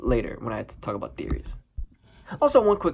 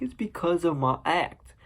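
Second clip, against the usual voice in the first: higher, slower, rising at the end; whiny excuse; 5 semitones higher than usual. Retold or imitated. Arthur explaining the gun to his bosses, whiny excuse. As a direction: plead it high and drawn out.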